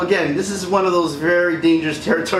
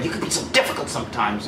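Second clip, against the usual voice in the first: fast and softer, a light Leia impression; quick, soft Leia imitation; 5 semitones lower, 5 dB quieter, faster than usual. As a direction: toss it off quickly and softly like Leia. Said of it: "she's like".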